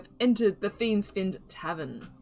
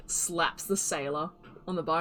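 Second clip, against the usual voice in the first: ordinary delivery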